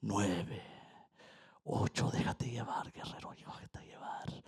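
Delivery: soft whisper of the guide talking him under; whispered countdown. Retold or imitated. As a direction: whisper it like lulling a warrior to let go. Guide counting down during ceremony.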